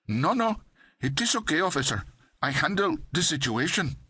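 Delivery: put-on high, sing-song Pakistani accent; heavy Pakistani accent. A narrator's reading. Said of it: "heavy, sing-song Pakistani accent".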